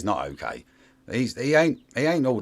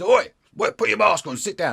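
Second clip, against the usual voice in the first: loud, high barked order; mock shout; 9 semitones higher, 5 dB louder than usual. Man yelling at someone to mask up and sit, mock shout. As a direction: bark it like an angry bouncer.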